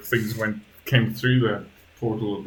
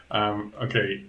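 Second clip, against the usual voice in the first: normal talking voice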